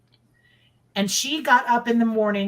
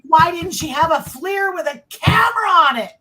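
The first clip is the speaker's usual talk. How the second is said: high, loud frustrated yell; exasperated shout; 8 semitones higher, 5 dB louder than usual. Retold or imitated. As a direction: shout it in disbelief. Retold frustrated outburst about FLIR.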